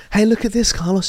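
high-pitched, acted call for attention; eager cousin. Voicing cousin urging Carlos.

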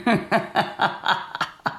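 breathy little conspiratorial laugh; scheming laugh. Real, not acted. The boss plots a date between coworkers, with a breathy scheming laugh. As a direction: chuckle at your own sneaky plan.

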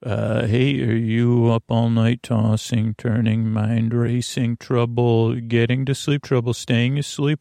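normal talking voice

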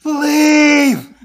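high, very loud mock yell; hammed-up shout. Co-host joining in Jack's meltdown, hammed-up shout.

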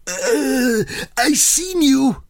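high, slow, plummy royal voice; King Charles impression. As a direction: drag it out like a doddering king. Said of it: "proper impression".

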